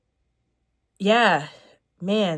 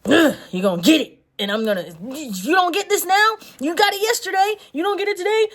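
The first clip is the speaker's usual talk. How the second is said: higher, louder mock exasperated instructor; tough instructor; 11 semitones higher, 6 dB louder than usual. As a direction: needle the student like an annoyed hard-nosed teacher. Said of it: "One was the hard".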